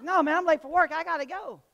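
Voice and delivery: high, loud mock-impatient reply; loud quoted reply. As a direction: throw the guy's brush-off out loud and high. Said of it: "He's like".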